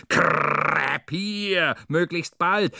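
damn him out loud; furious spat curse. Acted. Cursing the driver.

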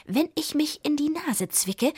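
high-pitched, spiteful witch; peevish and venomous. A narrator's reading. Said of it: "sagte die Zwickurlaub giftig".

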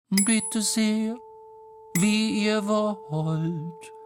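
high, very slow sung monotone; drawn-out high chant; tuning fork voice. Acted. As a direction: stretch it out high and level. Tuning fork character speaks.

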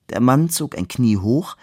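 ordinary delivery

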